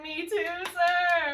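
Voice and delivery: slow, high, loud and laughing; laughing put-on Lucy; mock eager Lucy. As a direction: chirp it like an eager schoolgirl. Lucy quoted while laughing.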